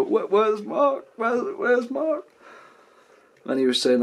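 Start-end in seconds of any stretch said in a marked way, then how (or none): 0.0-2.3 put-on very high, slow drunk wail; drunk confusion. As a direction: whine it out lost and drunk. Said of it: "so we go".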